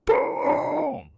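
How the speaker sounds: very high cracking boom; squeaky boom. Pretend. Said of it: "What was that last boom?".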